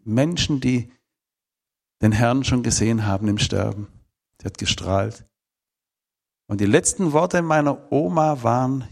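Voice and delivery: ordinary talk, nothing marked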